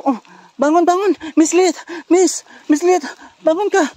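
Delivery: loud, high-pitched urging; urgent rousing. Acted. Rescuer telling fallen Miss Lilith to get up, urgent. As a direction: call out to wake someone who has collapsed.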